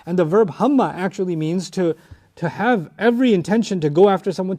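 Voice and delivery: usual speaking voice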